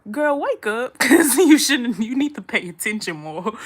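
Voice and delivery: high, loud yell breaking into a laugh; calling mama off the couch, loud and laughing; mock wake-up shout. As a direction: holler at her to wake up, laughing. Yelling at sleeping mama.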